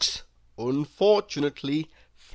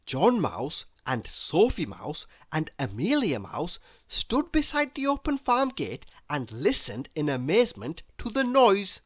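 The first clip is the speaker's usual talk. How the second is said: plain reading voice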